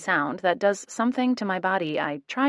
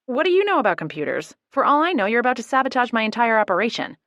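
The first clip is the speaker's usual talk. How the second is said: higher, louder and fast; flustered outburst; 5 semitones higher, 6 dB louder, faster than usual. A narrator's reading. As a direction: sputter it out in alarm. Panicking he'll wreck her computer.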